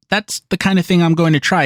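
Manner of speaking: usual speaking voice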